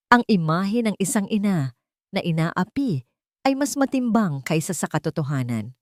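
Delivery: plain reading voice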